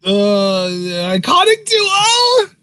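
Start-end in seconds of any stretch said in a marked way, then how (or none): 1.2-2.5 cries out the name high and loud; excited shout. Real, not acted. Co-host shouting the segment name in glee. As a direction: yelps the name in delight.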